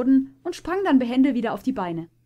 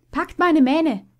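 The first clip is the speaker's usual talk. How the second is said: loud, high command; urgent loud call; 7 semitones higher, 9 dB louder, slower than usual. A narrator's reading. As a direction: call the others to grab on. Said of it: "rief Puma".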